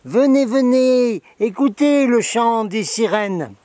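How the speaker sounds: high, slow luring siren voice; siren's call. Acted. To viewers, voicing the Starbucks siren, slow and luring. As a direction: lure them in like a singing siren.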